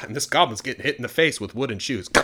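ordinary delivery, nothing marked

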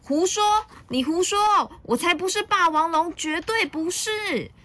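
high, indignant little-dinosaur cry; high-pitched childish outburst; loud protest. A narrator's reading. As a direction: deny it hotly, dragging the last words. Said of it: "小良很大声地说".

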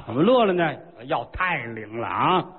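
ordinary talk

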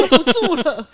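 laughing through the retold tantrum; giggling as she quotes her own sulk. Packing her bags after crying, mock sulk laughing.

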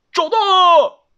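high, loud shout from up high; excited, far-carrying call; calling out a find. Acted. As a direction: shout the discovery down to everyone. Spotting lost chick from treetop.

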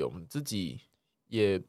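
ordinary talk

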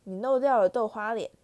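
ordinary delivery, nothing marked

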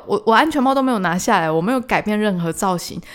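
ordinary talk, nothing marked

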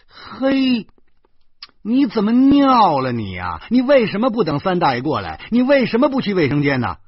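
loud, high exasperated scolding; angry scolding. Acted. Uncle scolding his nephew over the wet bed, loud and high. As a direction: blast the boy with questions.

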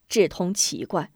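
usual speaking voice

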